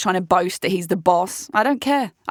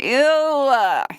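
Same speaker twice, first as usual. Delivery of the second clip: long drawn-out mock-disgusted ew; 3 semitones higher than usual. Woman recoiling from a man's hookup line, disgusted. Recoiling from overheard remark in retelling.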